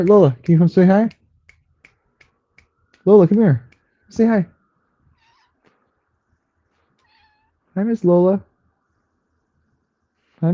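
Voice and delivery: high sing-song pet talk; cooing to the cat. Real, not acted. Streamer greeting her cat Lola, high cooing pet voice. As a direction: coo up high to a beloved cat.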